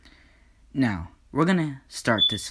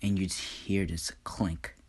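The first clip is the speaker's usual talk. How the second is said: plain reading voice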